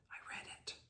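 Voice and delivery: short whispered reply. Retold or imitated. Reader whispering back to the rector, brushing him off. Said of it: "And he said".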